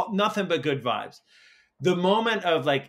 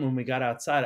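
ordinary talk

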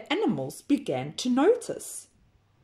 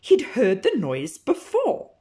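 ordinary delivery, nothing marked

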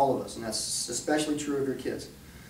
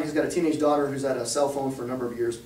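ordinary talk

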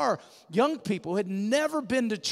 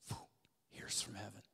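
whisper; 11 semitones lower, 19 dB quieter than usual. Acted. Preacher whispers the divine moment.

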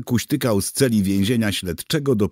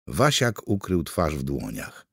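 plain reading voice

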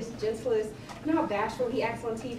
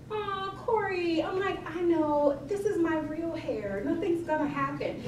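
high, drawn-out cooing; mock coy reassurance; 7 semitones higher, slower than usual. Chef reassures client about rain on her hair, cooing. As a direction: coo it high, stretching the words.

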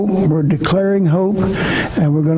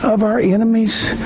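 higher, declaimed scripture; preaching the verse; 11 semitones higher than usual. Acted. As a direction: proclaim each word of the list. Preached reading of Romans.

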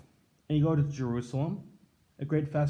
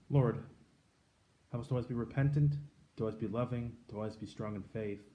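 quiet, prayerful petition fading softer to the end; closing prayer; 5 semitones lower, 8 dB quieter than usual. Acted. Priest leading the congregation in closing prayer, hushed and fading. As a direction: pray gently, letting the voice sink away.

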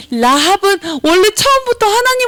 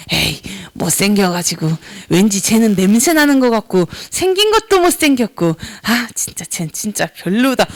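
low, grumbled mock contempt; disdain for friend; 8 semitones lower than usual. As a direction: grumble about a classmate under your breath. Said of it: "친구를 미워해요". (낮게 투덜대며 미워하듯)

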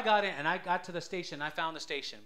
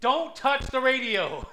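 loud, slow, higher warning; warning friends; 8 semitones higher, 7 dB louder, slower than usual. Retold or imitated. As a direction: warn the friends off the dial, drawn out. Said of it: "then I would tell my friends".

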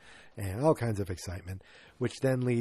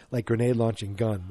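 ordinary talk

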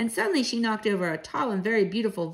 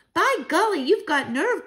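put-on high, punchy child's exclamation; impressed child; 7 semitones higher, 4 dB louder than usual. Grandchild admiring Grandmama's nerve, impressed.

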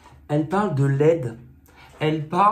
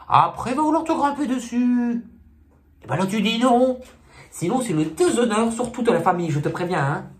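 comic sketch elder, warning; 5 semitones higher than usual. Acted. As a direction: warn Fortuné in escalating mock alarm. Plays sketch elder warning Fortuné.

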